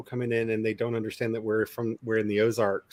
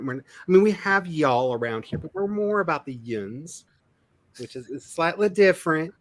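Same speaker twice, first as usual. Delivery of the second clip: ordinary delivery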